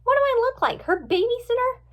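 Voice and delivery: high, loud retort; high-pitched indignant comeback. A narrator's reading. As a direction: throw the question back, offended. Friend indignant at being asked.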